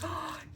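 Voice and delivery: quick high gasp; gasping at the pink glitter ones; delighted gasp. Retold or imitated. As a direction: gasp with glee. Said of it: "I was like".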